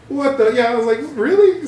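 high, loud disbelief; incredulous. Retold or imitated. Retelling a friend's cheeky request, high incredulous.

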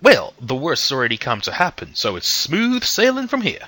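put-on cheerful, unbothered old soldier; a light touch of a smiling silver-haired gentleman; smiling old man. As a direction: smile through it, nothing can go wrong now. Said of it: "He sort of smiles".

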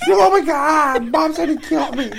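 high squeaky pet voice; dog's voice. Pretend. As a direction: voice the dog as a yelping squeak. Voicing the yelping dog.

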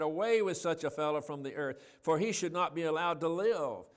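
forceful public denunciation. Acted. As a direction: shout it out like an outraged mob. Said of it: "They raised their voices and said".

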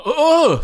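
high loud gasp for air; retold waking gasp; gasping awake. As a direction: gasp like coming back to life.

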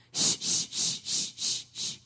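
repeated short unvoiced shushes; acted crowd-quieting hiss; shushing a crowd. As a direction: hush everyone with a string of shhs. Acting out hushing the crowd.

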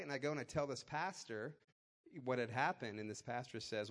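ordinary delivery

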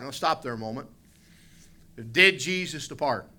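ordinary delivery

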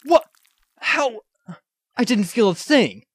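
a high, startled how; can't believe he was cut; stunned disbelief. Acted.

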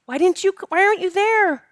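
high-pitched, accusing child imitation; angry, upset girl. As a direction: accuse like an abandoned little girl. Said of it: "she's getting angry, and she's crying".

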